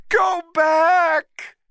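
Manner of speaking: very high, loud crying shout. A narrator's reading. Woman screaming at the ape to go back, high and loud. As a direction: scream it at him to save him. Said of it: "she shouted to him".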